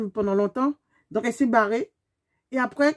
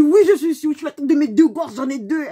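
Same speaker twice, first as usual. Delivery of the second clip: much louder, higher, half-laughing vow; emphatic oath; 4 semitones higher, 6 dB louder than usual. Retold or imitated. Swearing to the other driver on her kids, loud and heated. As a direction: swear it fiercely. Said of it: "Je dis".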